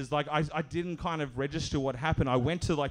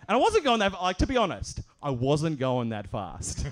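ordinary delivery

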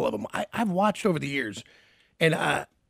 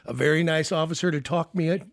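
usual speaking voice

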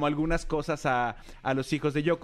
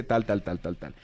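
trailing off; 9 semitones lower than usual. Real, not acted. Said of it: "trailing list fading".

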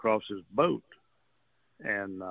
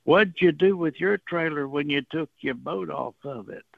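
lightly put-on country voice, higher and louder; half-mock country voice, raised high; country talking voice; 6 semitones higher, 5 dB louder than usual. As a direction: imitate the neighbor's country talk, pitched up. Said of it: "he's got this country talking voice".

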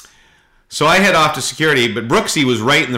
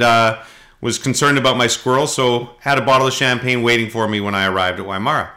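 ordinary delivery

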